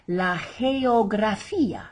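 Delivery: slow "geografía", first G like an H, hard "gra"; soft first G. Shown as a demonstration. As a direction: model "geografía" as heh-o-gra-FEE-a. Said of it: "we have both G's in one sentence".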